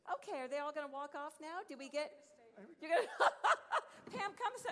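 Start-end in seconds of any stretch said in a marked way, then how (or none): 3.1-4.1 a burst of high flustered laughter. Real, not acted. Host laughing as the kids walk off the stage. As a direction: laugh out, caught off guard.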